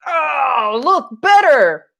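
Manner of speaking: high, whiny pleading at the painting; frustrated painter. Retold or imitated. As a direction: beg the brush to make it work, hammed-up. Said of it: "you've got that brush and you're just like".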